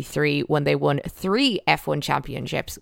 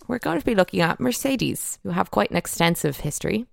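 ordinary talk

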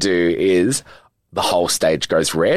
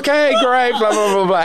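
mock high producer voice. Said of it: "it was like".

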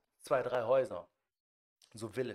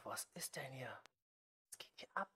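softer, breathy disbelief; stunned whisper; 13 dB quieter than usual. Retold or imitated. Guest staring at a mountain of drugs, whispering in disbelief. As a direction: whisper it, unable to believe your eyes. Stunned thought at the drug pile.

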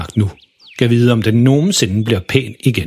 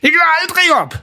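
high shout, pitch lifted hard; defiant shout. Acted. Refusing to quit on the moles, the father shouts it high. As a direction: vow it, never giving in. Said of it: "råber han".

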